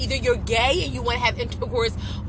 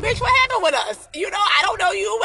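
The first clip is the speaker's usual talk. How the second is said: shocked outburst, pitched way up; incredulous inner shout; 7 semitones higher than usual. Retold or imitated. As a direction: yell it in disbelief. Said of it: "And I'm looking like".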